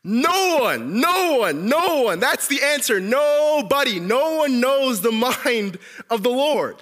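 loud, high, drawn-out emphatic shouts; shouted answer. Shown as a demonstration.

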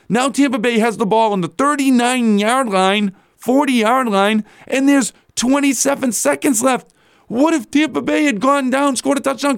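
a fired-up radio host laying out the worst case; exasperated rant. Real, not acted. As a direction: rant as if the mistake is unbelievable. Ranting about coach's blunder.

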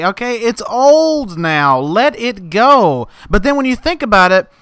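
ordinary talk

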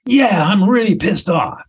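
rising high, ending in a resigned admission; building anger. Acted. Speaker finally admits being pissed off, high-pitched and heated. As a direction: let the anger climb, then admit it. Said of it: "I'll just do it".

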